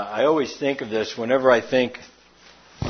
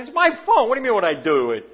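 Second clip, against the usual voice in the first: indignant retort; 9 semitones higher, faster than usual. Retold or imitated. Retold argument with repairman.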